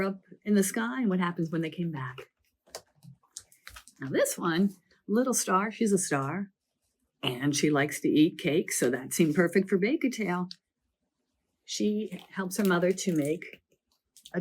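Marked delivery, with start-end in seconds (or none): none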